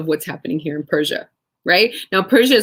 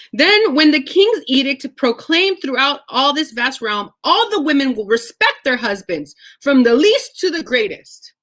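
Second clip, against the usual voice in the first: scripture reading; 7 semitones higher, 4 dB louder than usual. Acted.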